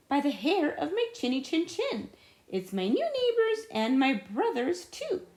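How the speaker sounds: friendly pig welcoming guests; little pig voice. Acted. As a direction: play the third pig warmly. Third little pig greets them.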